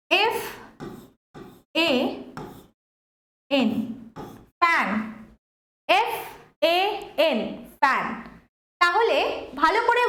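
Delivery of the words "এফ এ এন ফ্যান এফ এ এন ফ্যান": letter by letter with pauses; spelling out letters. Shown as a demonstration. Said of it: "আমি যদি একটা word লিখি".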